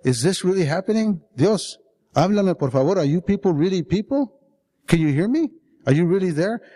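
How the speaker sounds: urgent questions pitched high; calling out loud. Acted. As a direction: call into emptiness, hoping for an answer. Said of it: "And I say out loud".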